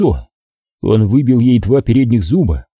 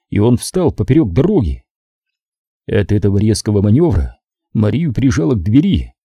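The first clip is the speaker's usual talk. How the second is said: ordinary delivery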